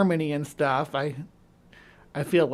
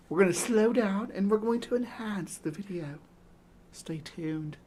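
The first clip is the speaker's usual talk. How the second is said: mock spooky show host; 4 semitones higher than usual. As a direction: tease a haunted-forest mystery like a TV host.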